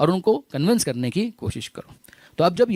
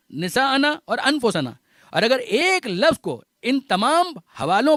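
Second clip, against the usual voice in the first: raised, ringing pulpit delivery; fervent preaching; 4 semitones higher than usual. Acted. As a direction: preach it to the congregation, building up. Sermon rises to full preaching.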